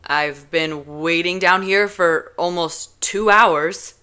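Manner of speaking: slow, loud grievance; fed-up scolding. Acted. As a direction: make her feel the wait. Kenji scolding after waiting.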